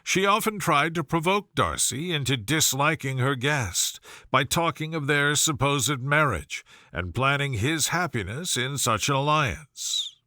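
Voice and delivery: ordinary delivery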